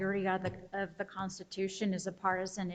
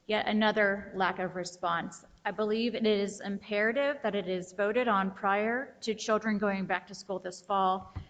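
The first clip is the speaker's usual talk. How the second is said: normal talking voice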